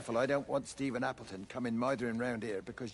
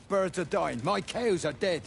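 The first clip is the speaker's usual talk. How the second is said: loud distress; 6 semitones higher, 6 dB louder, slower than usual. Acted. Farmer confronts son over dying animals.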